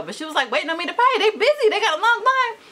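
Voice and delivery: high, fast, flustered rush; telling viewers about the panic at the drive-through window. Real, not acted.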